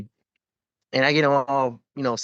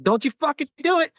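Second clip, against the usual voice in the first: much higher pitched urgent warning. Retold or imitated. Medic ordering a fainting Marine to stay up, high urgent warning. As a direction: order him not to pass out, pitched way up.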